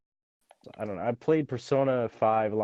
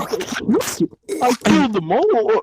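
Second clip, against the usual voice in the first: slow hammed-up villain; 10 semitones higher, 6 dB louder, much slower than usual. Joking villain line, slow far.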